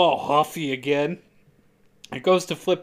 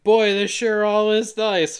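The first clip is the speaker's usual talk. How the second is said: put-on chipper girlfriend; 6 semitones higher, 5 dB louder, slower than usual. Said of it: "And she's like".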